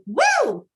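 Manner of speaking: high, loud "woo"; excited whoop. Shown as a demonstration. Showing how some people get out of bed, gleeful whoop. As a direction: whoop like an eager early riser. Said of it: "some people do rise up and".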